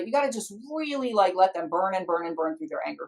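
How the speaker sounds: usual speaking voice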